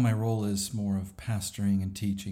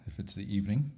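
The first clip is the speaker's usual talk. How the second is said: ordinary talk, nothing marked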